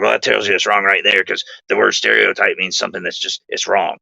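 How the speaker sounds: odd put-on voice, sure of himself; imitating Bill. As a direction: voice the buddy who sounds almost fake. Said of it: "I'm gonna do my best to imitate Bill's voice".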